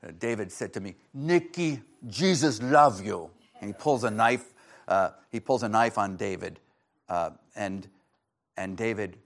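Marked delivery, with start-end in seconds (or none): 1.1-3.3 imitated Puerto Rican accent, louder; loud, put-on Puerto Rican accent; Nicky Cruz. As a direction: retell it in a Puerto Rican accent, pushing the words out. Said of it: "Puerto Rican accent".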